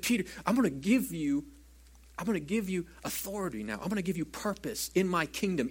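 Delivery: usual speaking voice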